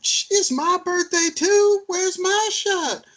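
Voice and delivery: very high, slow mock girly whine; hammed-up tipsy friend demanding her shot; friend's birthday whine.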